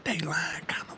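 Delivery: halting, conspiratorial whisper. Pretend. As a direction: back into a secretive whisper. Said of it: "We gonna whisper this".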